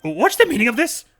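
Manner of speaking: high, loud courtroom outrage; outraged lawyer. Acted. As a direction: demand answers, scandalized.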